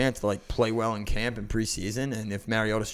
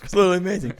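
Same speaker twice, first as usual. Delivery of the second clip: laughing; 8 semitones higher, 6 dB louder, much faster than usual. Real, not acted. Laughing about sleeping in dog bed.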